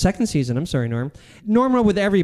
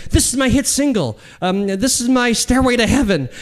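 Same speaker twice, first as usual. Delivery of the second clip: higher-pitched burst of self-belief; mock excitement; 7 semitones higher than usual. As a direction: sound briefly thrilled by your own idea. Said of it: "I get really excited".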